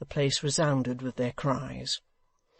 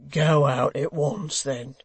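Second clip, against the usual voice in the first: slow, louder hangman retort; gruff hangman; 4 dB louder, slower than usual. Acted. Dennis the hangman answering Hugh, slow and gruff. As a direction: push him off, slowly. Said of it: "said Dennis".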